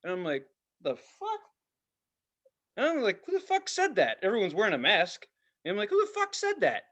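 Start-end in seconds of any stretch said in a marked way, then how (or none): 0.8-1.4 high-pitched, softer baffled question; mock bewilderment. Acting out his startled reaction. 3.2-4.2 high-pitched incredulous question; acted bafflement. Acting out his baffled reaction. 5.9-6.8 high-pitched incredulous question; acted bafflement. Repeats his baffled reaction.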